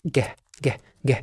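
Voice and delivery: ج as a short hard "g", each one separate; letter G sound. Shown as a demonstration. Teacher correcting the letter name, short hard G. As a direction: say ج as a quick "geh", not "jee". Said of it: "لا، ما بتنطقش كده".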